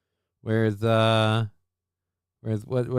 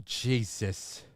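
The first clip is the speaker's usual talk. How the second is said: ordinary talk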